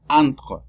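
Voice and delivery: French "entre" opening on nasal "an". Shown as a demonstration. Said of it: "E N se pronuncia an". (entre empezando con an)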